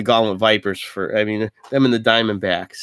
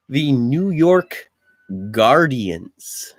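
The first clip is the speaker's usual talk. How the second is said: announcer voice; much slower than usual. Pretend. Announcing team names.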